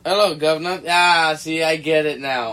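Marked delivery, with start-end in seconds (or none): 0.0-0.8 loud, higher, hammed-up Cockney greeting; exaggerated London street accent; bad London accent. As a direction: call it out in mock Cockney. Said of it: "That was a bad accent".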